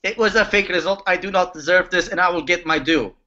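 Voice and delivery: put-on heavy Russian accent, menacing; broken English Russian mobster. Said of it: "Roman is speaking through him".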